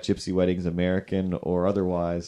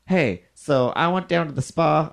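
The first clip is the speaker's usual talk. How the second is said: higher, louder put-on lady's voice; mock customer voice; 8 semitones higher, 4 dB louder than usual. Next customer chatting to the groomer, in a hammed-up put-on voice. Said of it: "the next lady comes in and she's like".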